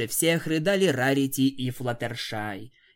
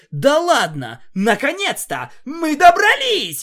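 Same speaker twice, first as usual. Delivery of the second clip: delighted squeal; 13 semitones higher, 10 dB louder than usual. Acted. Narrator squeals with joy reaching the factory, delighted squeal. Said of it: "визжал я от восторга".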